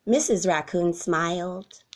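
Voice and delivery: ordinary delivery, nothing marked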